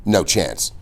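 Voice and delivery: ordinary delivery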